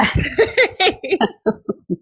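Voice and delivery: high, bright burst of giggles; giggling laugh. Real, not acted. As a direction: laugh with giddy excitement. Host giggles, excited.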